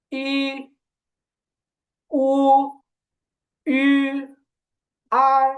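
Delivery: careful model read of ই উ আ; pinyin finals drill. Shown as a demonstration. Teacher rereads finals for the class. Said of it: "আমি তাহলে আরেকবার, আহ, পড়তেছি".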